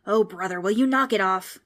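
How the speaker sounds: loud, fed-up outburst; exasperated ghost. A narrator's reading. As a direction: groan loudly at a pestering kid.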